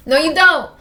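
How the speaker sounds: high, very loud insistence; emphatic protest. Real, not acted. Player insisting against the character's self-doubt, high loud protest. Repeats contradiction more emphatically.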